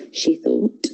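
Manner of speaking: plain reading voice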